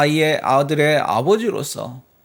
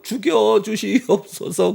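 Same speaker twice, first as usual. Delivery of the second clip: begging for mercy; 6 semitones higher, 3 dB louder, slower than usual. Retold or imitated. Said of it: "땅에 코를 박고".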